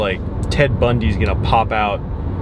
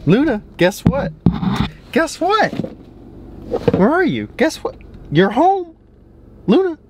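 high, excited pet-talk voice; excited dog talk; 14 semitones higher than usual. Real, not acted. Owner tells dog Luna she's home, excited dog talk. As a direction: squeal the good news to your dog.

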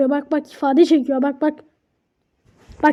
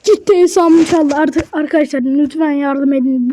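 usual speaking voice

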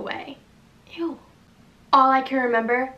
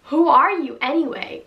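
louder, furious homeowner; angry; 3 semitones higher, 7 dB louder than usual. Acted. Girl yells at the man in her house, furious. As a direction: tell the intruder off hard. Acted quarrel with intruder.

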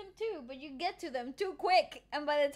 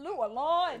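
copying his Australian vowels; Aussie accent; 4 dB louder than usual. Retold or imitated. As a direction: have a go at Australian. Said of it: "They just Aussie li".

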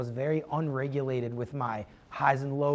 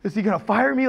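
mock panic; 6 semitones higher, 7 dB louder than usual. Retelling panic about being fired.

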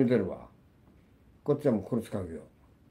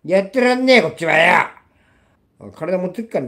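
real raging yell, much higher and louder; angry shout; 8 semitones higher, 9 dB louder than usual. Real, not acted. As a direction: explode in real fury. Furious rant about a ban. (激怒して怒鳴る)

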